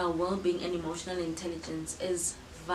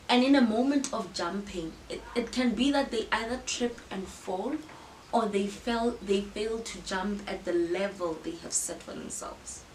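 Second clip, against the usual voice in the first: ordinary talk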